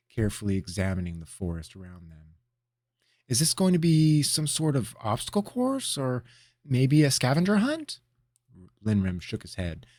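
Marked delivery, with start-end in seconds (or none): 3.3-8.0 high, lively, eager guess. Acted. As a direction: guess it like a keen kid. Apprentice eagerly guessing the task.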